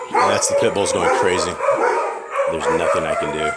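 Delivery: loud, talking over barking dogs. Real, not acted. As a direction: push the voice over the noisy pack. Shouting over barking pit bulls.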